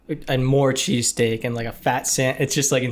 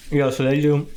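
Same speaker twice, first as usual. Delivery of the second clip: talking with mouth full; chewing through the words. Real, not acted. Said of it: "I'm talking with my mouth full".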